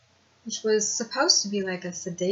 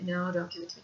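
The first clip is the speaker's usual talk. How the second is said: quiet frightened plea; soft plea; 2 semitones lower, 7 dB quieter, faster than usual. Retold or imitated. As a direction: beg softly, still voiced. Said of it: "But I would scream".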